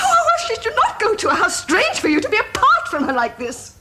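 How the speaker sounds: high, loud, wounded sarcasm; hurt, bitter outburst. Acted. Woman to cousin who slept with his wife, bitter outburst.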